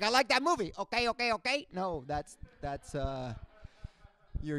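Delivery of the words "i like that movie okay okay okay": lightly voiced Cuban dad; dad's botched quote. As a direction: get the quote wrong like the dad. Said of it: "But I was like".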